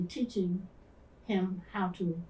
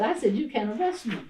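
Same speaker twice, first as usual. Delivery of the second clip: defiant repeat; 5 semitones higher, 6 dB louder than usual. Retold or imitated.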